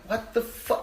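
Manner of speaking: high, exasperated retort; exasperated anger. Retold or imitated. Older cousin to screaming kid, pissed off. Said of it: "every time I get pissed off".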